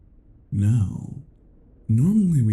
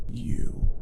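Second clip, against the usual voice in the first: whisper. Acted. Whispered end of taunt.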